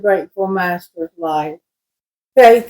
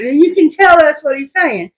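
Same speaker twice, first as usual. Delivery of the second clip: usual speaking voice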